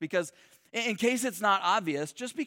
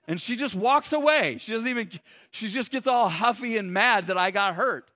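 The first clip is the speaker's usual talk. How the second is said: ordinary delivery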